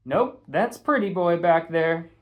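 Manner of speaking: sassy Patty. Acted. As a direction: tease him in front of everyone. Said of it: "she said".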